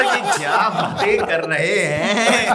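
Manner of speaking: loud, high, drawn-out teasing imitation; mocking impression. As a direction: mimic a friend's speech, laughing and exaggerated. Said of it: "तुम्हारी ना बिल्कुल ऐसी हो जाती है". (मज़ाक में ऊँची, खिंची हुई नक़ल)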